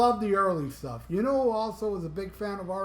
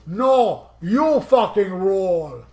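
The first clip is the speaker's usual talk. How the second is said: put-on thick German accent; 9 dB louder, slower than usual. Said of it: "He did it with a thick German accent".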